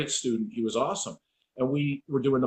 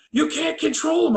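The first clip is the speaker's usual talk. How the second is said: high-pitched mock panicked yell; freaking out; 4 dB louder than usual. Cops arguing with the dog's trainer, retold freak-out. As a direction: imitate alarmed onlookers crying out. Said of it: "they're freaking out".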